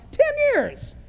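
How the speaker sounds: very high-pitched disbelief; incredulous emphasis. Acted. Jesus watched these guys ten years, incredulous. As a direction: squeal it in exasperated amazement. Marvels at how long it's been.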